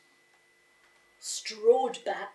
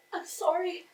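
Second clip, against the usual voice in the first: put-on sorry boy; 8 semitones higher than usual. A narrator's reading. Boy apologises to father.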